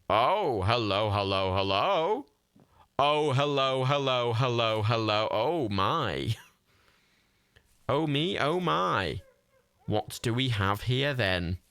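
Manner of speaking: slow, drawn-out theatrical relish; hammed-up delight. Player greeting a heap of random tat, hammed-up delight. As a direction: savour each word in mock wonder.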